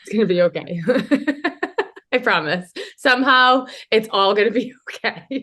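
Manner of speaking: reassurance breaking into real nervous laughter; laughing uneasily between the words. Real, not acted. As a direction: promise it through nervous giggles. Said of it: "Nervous laugh".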